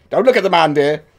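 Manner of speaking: hushing parent, prim and high; mother voice. Retold or imitated. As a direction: shoo the kid away like a fussy mum. Parent hushing child, quoted.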